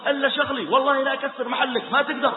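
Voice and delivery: bristling at the meddler; mock angry retort. Shop owner quarrel retold.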